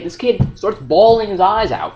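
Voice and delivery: normal talking voice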